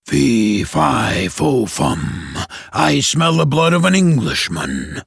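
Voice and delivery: low, booming giant chant; ogre voice. Acted. The ogre sniffing for Jack, low chant. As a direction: intone it like a hungry ogre.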